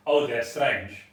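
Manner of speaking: ordinary delivery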